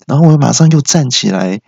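ordinary delivery

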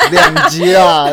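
slow, high, laughing. Real, not acted. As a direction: stretch it out laughing. Host laughs about episode length. (拖长音笑着说)

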